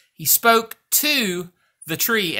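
TO drawn out and set apart; stress on TO. Shown as a demonstration. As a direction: lean slowly on the word to. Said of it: "now notice".

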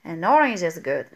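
low, slow "An orange is good" with a smooth linked n; correct form, relieved. Shown as a demonstration. Teacher contrasting the correct an orange after the choking demo. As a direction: ease into "An orange" as the right answer.

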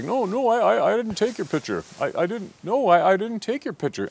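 high, stammering scared denial; threatened. Acted. Poor gentleman denying he took it, threatened stammer. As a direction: plead innocence, tripping over the words. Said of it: "he's looking, obviously threatened".

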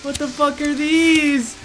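high, slow, stretched question; alarmed disbelief. Real, not acted. Player finding bodies in the cave, alarmed high voice. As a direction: draw it out in rising alarm. Finds horrifying things in cave.

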